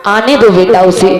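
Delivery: low, slowing, compassionate plea; calm them in a low, fatherly voice; gentle Buddha. Acted. Buddha asks followers not to hit her, gently. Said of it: "भगवान बुद्ध कहने लगे".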